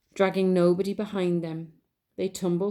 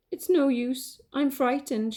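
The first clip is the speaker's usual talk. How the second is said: frightened mother; 6 semitones higher, slower than usual. Acted. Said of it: "she said".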